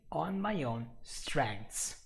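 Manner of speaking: "on my own strengths" said slowly, word by word; slow explanatory emphasis on "on my own strengths"; slow stressed phrase. Shown as a demonstration. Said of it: "you're actually saying".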